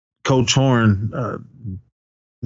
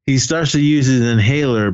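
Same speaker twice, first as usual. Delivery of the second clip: normal talking voice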